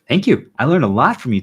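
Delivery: excited thanks. Retold or imitated. Thanking people whose way he learned from, in excited gratitude. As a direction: thank them like it truly made your day. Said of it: "And so I could be like".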